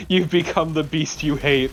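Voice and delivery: ordinary talk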